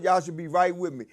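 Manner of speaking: usual speaking voice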